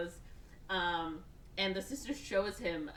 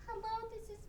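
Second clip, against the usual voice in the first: very high, soft phone greeting; put-on woman's voice; 5 dB quieter than usual. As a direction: imitate a man posing as his sister. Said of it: "he puts on the voice".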